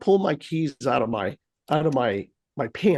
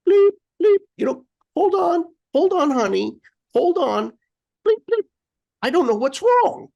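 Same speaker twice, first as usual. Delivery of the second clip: high, loud mock cashier with beeping; flustered cashier beeping the scanner, much higher; imitating the cashier; 12 semitones higher, 4 dB louder than usual. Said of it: "the lady comes over and she's like".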